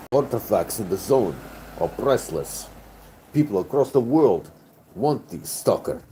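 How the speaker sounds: lightly put-on Russian accent, grand and solemn; mock Russian stalker. Streamer hams up a Russian stalker for chat, a light Russian accent. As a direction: preach the stalker's creed in a faint Russian accent.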